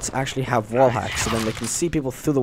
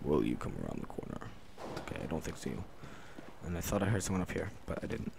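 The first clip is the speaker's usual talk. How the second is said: hushed, much softer, half whispered; stealthy whisper; 3 semitones lower, 13 dB quieter than usual. Real, not acted. Hiding in a corner waiting for an enemy, whispering. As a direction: keep it low so nobody hears you. Sneaking up in game.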